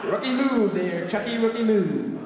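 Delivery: high, drawn-out singsong jeer; mocking taunt. Comic taunting the priest who failed to catch him, high mocking jeer.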